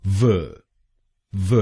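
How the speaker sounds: व as a short "vuh"; syllable demo. Shown as a demonstration. Teacher shows the third syllable as व. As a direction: clip व to a quick "vuh". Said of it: "तीसरे शब्दांश को यानी वीए हम बोलेंगे".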